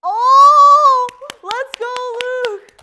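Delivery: loud high cheer; cheering shout. Real, not acted. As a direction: yell it for the winner. Cheering winner.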